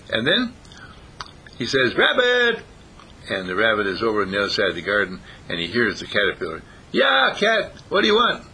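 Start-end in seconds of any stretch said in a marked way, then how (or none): 1.9-2.6 high, drawn-out call. Acted. Caterpillar calls rabbit across the garden, high holler. 6.9-8.4 high-pitched rabbit holler; rabbit calling back. Acted. Rabbit answers caterpillar across the garden, calling back high.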